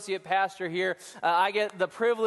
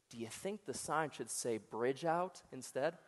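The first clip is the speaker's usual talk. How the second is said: quiet punchline; 7 semitones lower, 12 dB quieter than usual. Acted.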